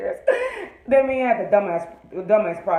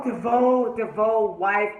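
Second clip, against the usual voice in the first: ordinary talk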